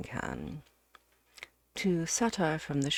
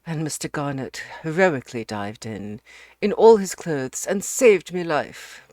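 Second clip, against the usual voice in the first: professor overflowing with thanks; effusive professor; 8 dB louder than usual. Acted. Professor praises his rescuer.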